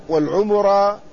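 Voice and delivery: والعمرة with an extra u on the m; second variant. Shown as a demonstration. Lecturer listing three variants, والعمرة as wal-ʿumurā. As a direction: say والعمرة as wal-ʿumurā, u after ʿayn and mim. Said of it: "فيها ثلاث لغات".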